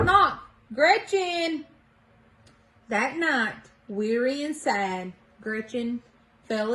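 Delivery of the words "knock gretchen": calling out. A narrator's reading. Visitors knocking and calling out.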